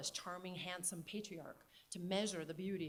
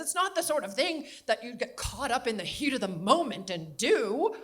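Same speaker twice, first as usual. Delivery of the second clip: put-on very loud, higher New England accent; 4 semitones higher, 11 dB louder than usual. Grandmother repeating her point to John, very loud New England accent. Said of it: "New England Katharine Hepburn accent".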